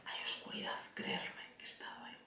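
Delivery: hushed, breathy secret; whisper. Real, not acted. Confiding her dark past to viewers in a whisper. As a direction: lean in and whisper it.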